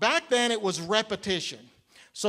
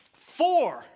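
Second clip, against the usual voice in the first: high, loud triumphant count; loud excited call, much higher; 8 semitones higher, 4 dB louder than usual. Real, not acted. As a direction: announce it like a scoreboard.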